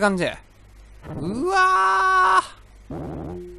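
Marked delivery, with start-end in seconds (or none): 1.2-3.5 drawn-out high-pitched shriek of shock; a long high "uwaa" of dismay; long high wail. Real, not acted. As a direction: let out a long, stretched scream of disbelief. Shocked by the game level.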